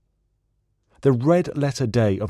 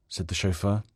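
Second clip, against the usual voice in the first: plain reading voice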